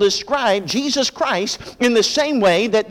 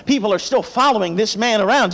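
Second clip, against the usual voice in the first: ordinary talk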